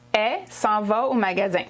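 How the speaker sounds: 'elle' said as a bare 'è' with no L; Quebec contracted elle. Shown as a demonstration. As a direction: cut the L so 'elle' is just 'è'. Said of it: "only the son est".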